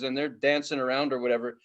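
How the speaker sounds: normal talking voice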